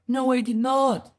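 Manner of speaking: high, loud denial; acted indignant innocence; protesting denial. Ghirab denies the accusation.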